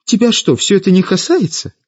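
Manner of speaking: high, exasperated challenge; losing patience. A narrator's reading. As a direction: demand an answer, pitch jumping up. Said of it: "не выдержала Светлана".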